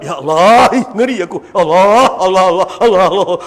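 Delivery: low, trembling cries of fear to God; terrified old man. Retold or imitated. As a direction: shudder at hearing of the torment. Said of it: "kau cerita azab". (rintihan takut yang rendah kepada Allah)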